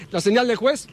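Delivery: ordinary talk, nothing marked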